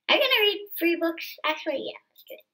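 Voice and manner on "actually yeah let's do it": usual speaking voice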